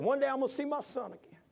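high-pitched imitation of a tearful preacher; retold tearful cry. Preacher leaning over the pulpit about his dead son, retold tearful cry. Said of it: "pulpit and cried".

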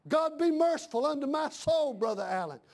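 ordinary delivery

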